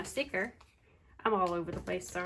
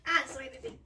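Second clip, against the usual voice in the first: pet voice; 6 semitones higher, much faster than usual. Real, not acted. Talking to cat on lap.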